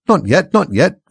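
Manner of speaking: high, loud, urgent objection; hasty interruption. A narrator's reading. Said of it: "the rabbit hastily interrupted".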